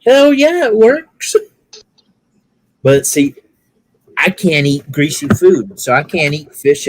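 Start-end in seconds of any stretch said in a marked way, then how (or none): 0.1-1.3 excited retort. Retold or imitated. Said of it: "I'm like".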